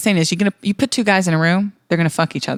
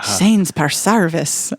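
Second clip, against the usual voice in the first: put-on slow touchstone phrase in Irish accent; exaggerated amateur Irish accent; much slower than usual. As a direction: find the Irish accent, drawn out. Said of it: "What's my touchstone?".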